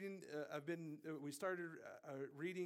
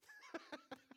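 derisive laugh. Acted. God laughing at the rebels, high derisive laugh. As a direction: put on a high giggle of scorn.

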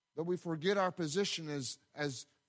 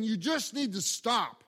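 loud, slow and emphatic, pitched up; firm, heated command; 5 semitones higher, 5 dB louder, slower than usual. Retold or imitated. Coach to a man blowing his top, heated command. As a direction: order it to stop, stretching out each word. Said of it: "I said it just like that".

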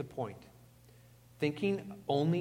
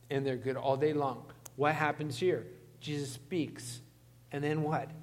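ordinary talk, nothing marked

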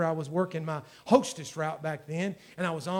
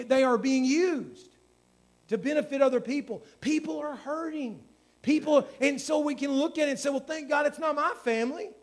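raised, pleading preacher's cry, slowing on key words; preaching at full force; 7 semitones higher, 5 dB louder than usual. Acted. Pastor urging church to pray, full-force preaching. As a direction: build the appeal louder and higher.

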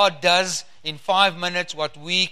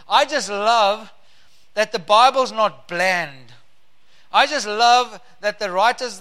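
normal talking voice

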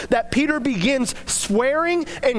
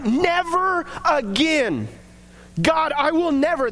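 emphatic vow; 5 semitones higher than usual. Retold or imitated. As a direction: stretch out the vow as if imitating firm resolve. Acting a vow after relapse.

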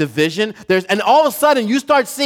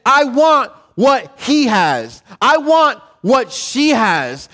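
slow, grasping "I want what he has"; envious attitude; 3 semitones higher, slower than usual. Retold or imitated. Preacher voicing a jealous believer, slow and grasping. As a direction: drag out the wanting like a jealous heart. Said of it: "the attitude that says".

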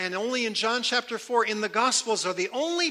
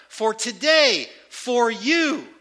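slow, louder, leaning on "today" and "you"; emphasis on today and you; 3 semitones higher, 5 dB louder, much slower than usual. Shown as a demonstration. Preacher stressing the Greek word order to listeners, emphatic. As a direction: hammer "today" then "you" with falling stress. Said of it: "That's brought all the way to the front".

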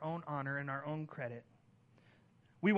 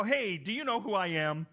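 indignant; 4 semitones higher, 7 dB louder than usual. Retold or imitated. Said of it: "do you get all indignant and go".

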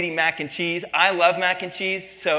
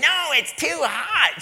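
much higher, slow, irritated complaint; mad customer; 10 semitones higher, slower than usual. Retold or imitated. An annoyed customer rejecting the waiter's coffee, much higher and slow. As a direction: snap it back like an annoyed diner. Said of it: "She was, like, mad at me".

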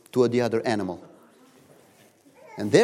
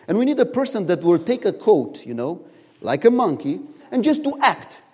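zoo owner; 7 semitones higher, 5 dB louder than usual. Retold or imitated. Zoo owner explaining the job.

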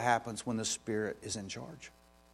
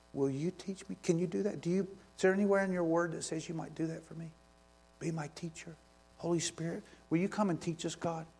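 soft, higher pleading prayer to the Holy Spirit; hushed prayer; 4 semitones higher, 4 dB quieter than usual. Real, not acted. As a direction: quietly ask God to teach you. Prays softly to the Spirit.

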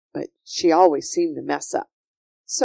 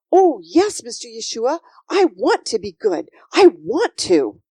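put-on higher, louder, eager little girl; eager Sophie; 4 semitones higher, 6 dB louder than usual. Sophie answers Yeshua's offer, eager and wholehearted. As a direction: plead eagerly to be good.

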